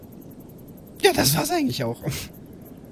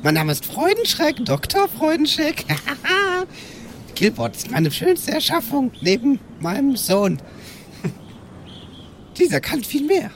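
high, cackling mad doctor voice; proud mad scientist showing off his creation; 12 semitones higher than usual. Acted. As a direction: pitch it up like a gleeful lunatic inventor. Player voices mad scientist character.

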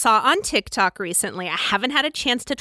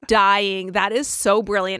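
normal talking voice

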